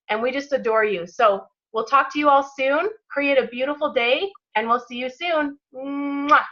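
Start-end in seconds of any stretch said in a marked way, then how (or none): 5.7-6.4 blown kiss. Real, not acted. Signing off the call to the team, kiss sound. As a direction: send them a big kiss. Blowing kiss goodbye to callers.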